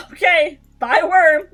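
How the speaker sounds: cries out bye through laughter; loud laughing goodbye. Real, not acted. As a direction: shout it while cracking up. Laughing farewell to the bit.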